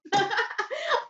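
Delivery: high giggling laugh; cracking up. Real, not acted. Host cracked up by the show, high giggle. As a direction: burst out giggling.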